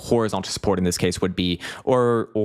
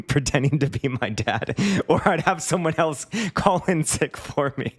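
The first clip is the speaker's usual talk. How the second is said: higher voice, talking through laughter; laughing; 7 semitones higher than usual. Real, not acted. Gamer admitting his teenage truancy trick, laughing. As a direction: confess it while cracking up.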